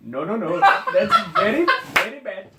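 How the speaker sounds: hammed-up Indian accent impression, stretching very; exaggerated Indian accent, mocking the customer. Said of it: "continue this episode with this accent".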